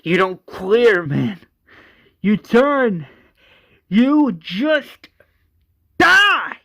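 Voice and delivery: loud, high, building rant; acted frantic scream; crazed screaming. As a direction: break it into yelled bursts, peaking on the last. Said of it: "He's screaming".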